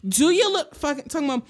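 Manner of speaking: ordinary delivery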